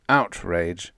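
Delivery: ordinary delivery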